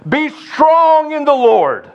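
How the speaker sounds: loud, high, slow rallying call; rally the troops with it; rally cry. Shown as a demonstration. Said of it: "It's a rally cry".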